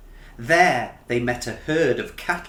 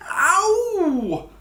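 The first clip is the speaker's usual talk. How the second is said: high, sharp yelp of pain; 3 dB louder than usual. Acted. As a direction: yelp as the thorns prick. Bert dives into thorny bush.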